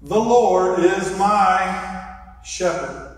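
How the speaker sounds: slow proclamation. Shown as a demonstration. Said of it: "So here we go. Put your hands back up".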